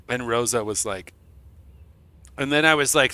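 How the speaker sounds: ordinary talk, nothing marked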